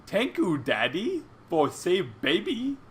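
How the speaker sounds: put-on cutesy baby talk, lilting up high; baby fluffy voice. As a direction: speak as a tiny grateful creature. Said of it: "you mumble out to him".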